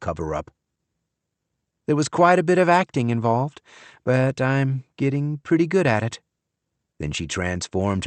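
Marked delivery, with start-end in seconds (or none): 1.9-6.2 put-on young woman voice. A narrator's reading. Maggie voiced by narrator.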